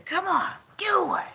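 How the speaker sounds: high, breathy whisper; whispered urging. Retold or imitated. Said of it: "I'm just sitting there".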